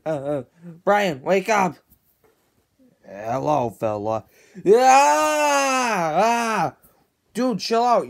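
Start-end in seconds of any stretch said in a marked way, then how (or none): 4.7-6.8 high, loud panicked scream; terrified scream. Acted. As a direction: shriek in sudden terror. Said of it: "You're screaming like a stinking maniac".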